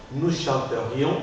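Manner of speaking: chanterions measured out as "chan-te-rions", slow; mute E pronounced. Shown as a demonstration. Teacher models the conditional for student, chanterions with E articulated. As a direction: slowly sound the E before -rions in chanterions. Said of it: "je l'articule".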